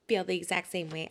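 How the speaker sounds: usual speaking voice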